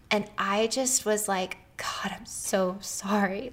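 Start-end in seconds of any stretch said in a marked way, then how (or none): 1.8-3.5 slow, softer hushed whisper of apology; broken whisper. Retold or imitated. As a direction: breathe it out as if falling apart. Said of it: "Like, I just fell apart".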